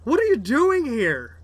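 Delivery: high, loud startled challenge; dwarf shout. Acted. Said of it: "The dwarfs are going".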